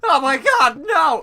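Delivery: shrieked, high and very loud; mock panic. Player overacting the poison warning, panicked shriek. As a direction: cry out in over-the-top horror. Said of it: "A voice changes".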